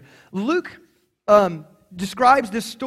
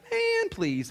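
very high, softer "Man, please"; mock dismissive; 12 semitones higher, 5 dB quieter than usual. Preacher showing how Jesus did not say it, mock dismissive. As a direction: toss it off in a high comic voice. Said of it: "This wasn't like".

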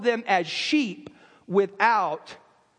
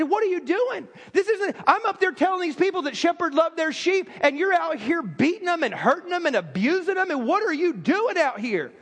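higher pitched, mildly heated confrontation; angry rebuke; 6 semitones higher than usual. Retold or imitated. Tour guide confronting the man beating sheep, mildly heated rebuke. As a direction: retell the guide's rebuke with rising indignation. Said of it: "he's so mad".

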